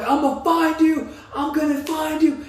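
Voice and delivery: high, sustained mock threat; retold screaming. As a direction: yell it like a pursuer, imitated. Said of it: "he's screaming as he's like".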